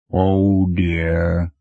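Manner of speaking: slow, heavy voice of a mountain; rumbling mountain voice. Acted. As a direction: speak slowly, like a mountain talking. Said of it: "its voice about what you'd expect from a mountain".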